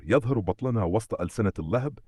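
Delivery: usual speaking voice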